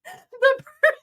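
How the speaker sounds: high and squealing with laughter; laughing. Real, not acted. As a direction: laugh out the words. Still cracking up.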